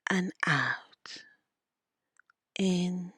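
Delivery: slow meditation voice with long pauses; guided meditation. Acted. Leads a guided visualization.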